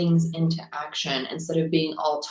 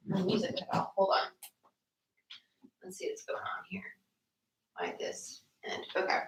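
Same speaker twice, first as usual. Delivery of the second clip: near whisper, under her breath; muttering to herself; 11 dB quieter than usual. Real, not acted. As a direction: mutter it off-mic while fiddling. Fixing the stream music, muttering aside.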